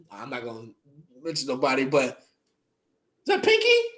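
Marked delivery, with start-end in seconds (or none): 2.2-3.9 high, drawn-out incredulous squeak. Acted. Gym-goer spotting porn on someone's screen, high and incredulous. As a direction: stretch it out high in mock disbelief. Loud shocked reading of a screen.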